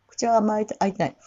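ordinary talk, nothing marked

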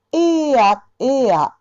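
えーや through the nose; nasalised えーや; 鼻にかけて; nasal e. Shown as a demonstration. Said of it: "微濁音でね".

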